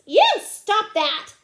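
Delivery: loud, high, slow protest; indignant. Acted. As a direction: scold the one pulling your curls, loudly. Girl protesting at hair pulling.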